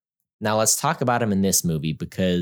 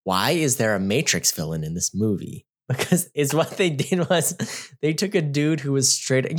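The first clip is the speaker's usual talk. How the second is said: ordinary talk, nothing marked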